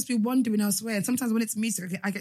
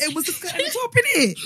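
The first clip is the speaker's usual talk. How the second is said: higher, laughing self-defence; laughing protest; 8 semitones higher than usual. Real, not acted. Teased guest defends her party outfit, laughing protest. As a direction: protest through laughter, pitch climbing.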